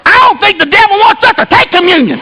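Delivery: put-on high, earnest child voice; little kid. Little son advising dad about communion, kid voice. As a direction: imitate a small boy piping up. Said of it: "He's a little kid".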